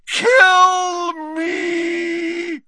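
long, very high agonized scream; Dumbledore yelling. A narrator's reading. Dumbledore after draining the glass, agonized yell. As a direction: scream it out, stretched and loud. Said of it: "than he yelled".

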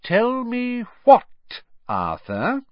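wife's warning tone; dangerous voice. A narrator's reading. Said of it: "repeated in a dangerous sort of voice".

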